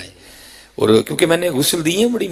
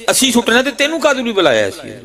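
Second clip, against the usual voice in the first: much higher, indignant mimicry; angry retort; 12 semitones higher than usual. Son challenging the cleric called to wash his father, high-pitched mimicked anger. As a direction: throw back the son's offended question, pitched right up. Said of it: "بڑے غصے میں کہتا ہے".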